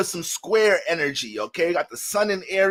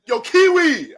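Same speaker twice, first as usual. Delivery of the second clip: mock call across the room, higher and louder; 9 semitones higher, 6 dB louder, slower than usual. Manager calling him Kiwi at work, loud mock call. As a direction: shout the nickname like a coworker across the office.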